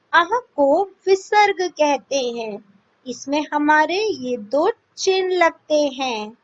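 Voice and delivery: normal talking voice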